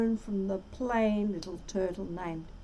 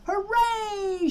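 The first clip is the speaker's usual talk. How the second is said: high cheer of triumph; joyful shout; 8 semitones higher than usual. A narrator's reading. Yertle cheering from forty miles up, a high joyful shout. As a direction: whoop it up. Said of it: "shouted Yertle".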